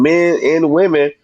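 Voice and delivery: ordinary talk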